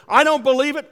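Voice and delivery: loud, high yell imitating an angry lady. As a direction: yell over the other person's words. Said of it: "just yelling at me".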